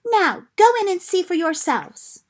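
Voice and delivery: excited cry. Acted. Lucy crying out to the others at the wardrobe. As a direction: fling it open and show them. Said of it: "and cried".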